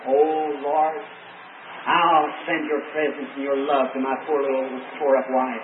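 soft, low inward prayer; hushed prayer for his tired wife; inner prayer. Retold or imitated. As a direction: pray it silently, under the breath. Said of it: "I'm thinking in my heart".

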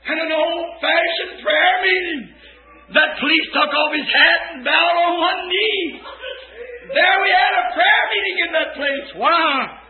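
loud, high revival preaching; preaching at full force. Real, not acted. Preacher recounting the prayer meeting, shouting high and loud.

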